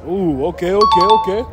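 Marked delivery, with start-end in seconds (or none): none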